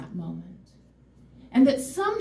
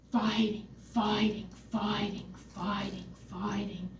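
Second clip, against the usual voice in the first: each fighting softer until it's nearly gone; trailing off from soft to almost nothing; fading repetition; 2 semitones lower, 10 dB quieter than usual. Real, not acted. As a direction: fade the word into silence. Repeated word fading away.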